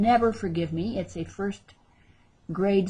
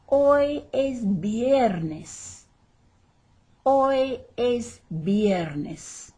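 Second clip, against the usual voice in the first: "Hoy es viernes" dragged out, "hoy" raised; very slow drill; 4 semitones higher, much slower than usual. Shown as a demonstration. Teacher drilling the sentence for practice, very slowly. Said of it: "good practice".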